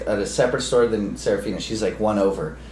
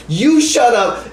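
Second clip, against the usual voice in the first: put-on high, loud little-kid retort; a tiny kid firing it back at a drunk; little girl talking back; 10 semitones higher, 5 dB louder, much slower than usual. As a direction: toddler in a stroller shouting back at a drunk. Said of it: "and she goes".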